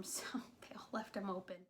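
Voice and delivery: soft laughing whisper; whispered aside. Real, not acted. Joking about layered jackets, whispered. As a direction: drop to a chuckling hush.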